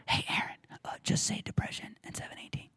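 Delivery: hushed, confiding whisper; whispered prompting. Retold or imitated. God quietly prompting the preacher, whispered. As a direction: whisper it like a private nudge.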